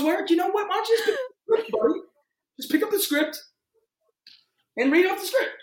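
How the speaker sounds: high, patronizing put-down; mocking director. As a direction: talk down to him like a fed-up director. Director snapping at forgetful actor.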